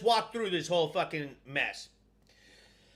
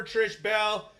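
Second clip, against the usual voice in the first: usual speaking voice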